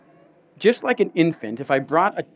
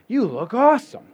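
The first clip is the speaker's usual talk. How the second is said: put-on bright, much higher, louder kid voice; chirpy kid; 7 semitones higher, 4 dB louder than usual. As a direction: gush it like a child. Kid's compliment, replayed.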